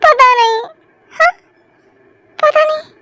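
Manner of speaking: high, tearful I-don't-know; crying. Acted. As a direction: sob out the same answer. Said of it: "बार बार यही हर सवाल पे कहा है". (रोती हुई ऊँची आवाज़ में)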